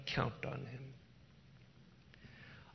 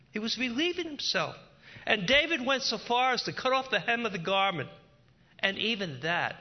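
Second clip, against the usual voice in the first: fast, high, loud sermon; fervent preaching; 7 semitones higher, 5 dB louder than usual. Acted. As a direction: drive the story on urgently.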